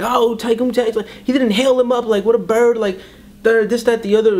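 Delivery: high, mocking whine of gossiping haters. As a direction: sneer it like people talking trash online. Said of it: "people are like".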